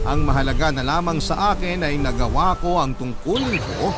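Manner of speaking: ordinary talk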